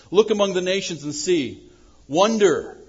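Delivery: "Look among the nations" called out loud and high, LOOK hit hard; proclaimed aloud. Shown as a demonstration. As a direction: proclaim it to the whole room.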